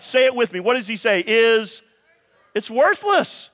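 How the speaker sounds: ordinary talk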